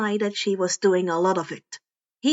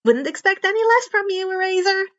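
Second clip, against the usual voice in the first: much higher, cocky and teasing; grinning Karma; 8 semitones higher, 4 dB louder than usual. Acted. Karma teasing Eraser back, higher and grinning. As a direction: tease the mentor with a grin you can hear. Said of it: "Karma replied as he continued to grin".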